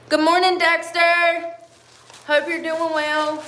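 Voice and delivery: high cooing hello to Dexter; sweet talk to a pet; pet greeting. Real, not acted.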